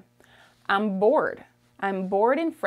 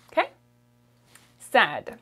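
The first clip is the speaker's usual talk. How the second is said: usual speaking voice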